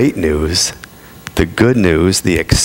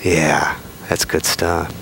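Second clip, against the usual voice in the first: normal talking voice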